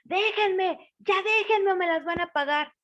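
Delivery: high, loud shrieking plea; witch screaming. A narrator's reading. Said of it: "la totola empezó a gritar y decía". (grito agudo y fuerte de bruja acorralada)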